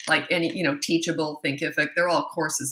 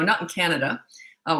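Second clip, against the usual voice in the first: normal talking voice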